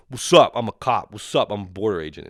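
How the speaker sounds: loud mock-cocky greeting; mock bragging. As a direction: announce it like a swaggering cop. Mocks overeager cop greeting people.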